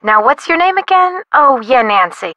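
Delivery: ordinary talk, nothing marked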